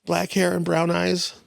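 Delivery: a hint of a kid describing his friend; young son's voice.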